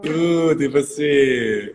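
high, loud, drawn-out reply; delighted greeting. Real, not acted. Host asks guest how she is, delighted singsong. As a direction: sing the question back happily.

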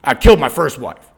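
low, flat, menacing statement; deadpan menace. Retold or imitated. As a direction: say it cold and dead serious. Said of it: "he looks at me and he goes".

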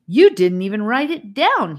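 an excited cry of protest; exclaimed. A narrator's reading. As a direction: burst out in protest. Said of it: "he exclaimed".

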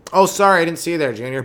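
put-on oops-sorry; mock apology. Acts fake apology to the bully.

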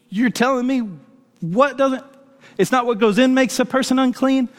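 high-pitched retold disbelief; incredulous. Friend questioning the verse at the kitchen table, incredulous. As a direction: imitate a friend who can't believe it.

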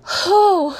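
sharp, high, loud "whew"; emotional exhale. Real, not acted. Speaker pauses while getting emotional about being alone, emotional exhale. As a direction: blow out a real, heavy breath to steady yourself. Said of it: "I'm getting a little bit emotional".

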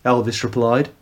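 usual speaking voice

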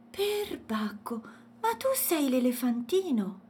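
put-on high, amazed child; astonished exclamation. A narrator's reading. Woken girl recognises the elephant, astonished. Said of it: "esclamò Matilde stupita".

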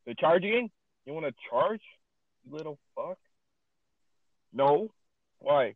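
exasperated and loud, bursting out; actually getting mad. Real, not acted. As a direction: grill the broken charger with rising questions. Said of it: "fucking actually getting mad".

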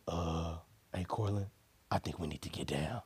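low, soft imitated voice; quietly tip off a friend; hushed, hesitant mock warning; cautious low warning.

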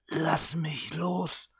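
slow croaking whisper. A narrator's reading. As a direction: rasp it out through a choked throat. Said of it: "krächzte sie".

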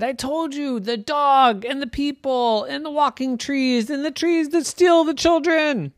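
put-on high, urgent child; insistent Jenny. Jenny answering her laughing brothers, high and urgent. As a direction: list the fears again.

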